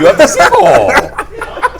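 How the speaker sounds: very high, slow mock Russian; loud, stretched-out parody Russian accent. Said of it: "This is the Russian".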